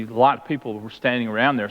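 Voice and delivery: normal talking voice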